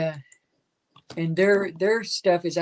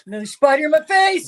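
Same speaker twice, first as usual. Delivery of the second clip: higher, louder mocking whine; mimicking a frightened hiker, higher and louder; mock scared squeal; 8 semitones higher, 9 dB louder than usual. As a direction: ham up his panicked complaint.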